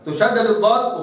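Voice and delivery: usual speaking voice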